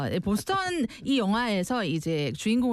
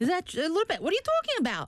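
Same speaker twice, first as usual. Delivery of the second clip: much higher, indignant New Yorker protest; mock offended New Yorker; 9 semitones higher, slower than usual. Host answering a listener comment as an offended New Yorker. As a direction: play the touchy New Yorker pushing back at the listener. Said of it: "New Yorkers sound a bit upset all the time".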